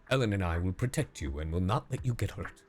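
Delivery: ordinary talk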